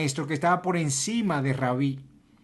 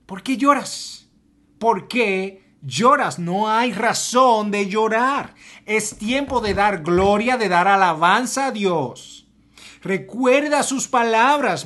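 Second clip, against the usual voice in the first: louder, higher, slow insistent call; pleading exhortation; 5 semitones higher, 7 dB louder than usual. Acted. Angels urge weeping Mary to praise, loud and slow. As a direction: urge her to stop weeping. Angels' question voiced emphatically.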